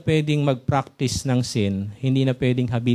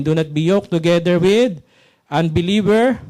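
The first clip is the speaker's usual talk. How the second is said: verse read out louder, ending on a lift; proclaimed scripture; 4 dB louder than usual. Shown as a demonstration. Preacher proclaiming Second Corinthians to the church, louder. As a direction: ring the verse out to the congregation. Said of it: "So, Second Corinthians six fourteen".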